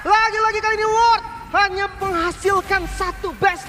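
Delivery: excited caster. Real, not acted. Caster hyping a defense.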